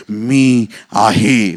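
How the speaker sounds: loud, very slow, drawn-out words; solemn declaration. Acted. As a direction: declare it slowly with great weight. Jesus declares the same name.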